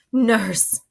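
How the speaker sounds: ordinary talk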